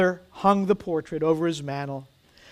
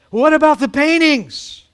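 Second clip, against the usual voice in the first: high, loud protest; outraged shout; 9 semitones higher, 8 dB louder than usual. A narrator's reading. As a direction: cry out in disbelief.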